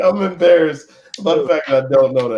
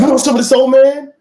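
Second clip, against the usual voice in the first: mock tough old man; 12 semitones higher, 7 dB louder than usual. Mocking the patient's challenge.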